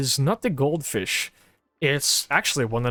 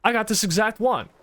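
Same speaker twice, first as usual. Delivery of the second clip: mock excited fan; 7 semitones higher than usual. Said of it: "go up to the ones on display and go".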